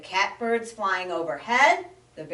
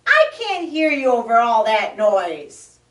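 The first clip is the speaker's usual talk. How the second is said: louder, straining to be heard; yelling over noise; 3 semitones higher, 9 dB louder than usual. Acted. Muskrat yells over the frog's noise. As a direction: shout over the racket. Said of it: "yelled the Muskrat".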